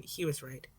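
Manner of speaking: ordinary delivery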